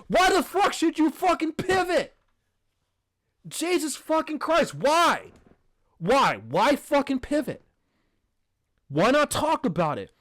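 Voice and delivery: real exasperated outburst, high and punched; outraged. Real, not acted. Podcaster outraged that hosts would pivot away from Gaza. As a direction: demand an answer in high-pitched disbelief.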